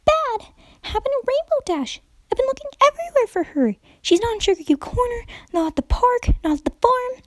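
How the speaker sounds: very high, bubbly cartoon pony voice; giddy high pony explaining her twitches; hyper Pinkie Pie. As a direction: chatter it like an excitable party pony.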